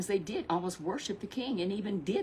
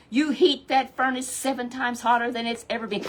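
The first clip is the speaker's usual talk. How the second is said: loud angry king order; 5 semitones higher, 7 dB louder than usual. Acted. Angry king orders furnace heated.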